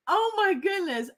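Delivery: ordinary delivery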